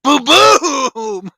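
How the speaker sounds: loud, high mouth-made gunshots; imitated shotgun blasts. Friend acting out the granny's shotgun, loud boom sounds. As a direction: blast out two shotgun booms.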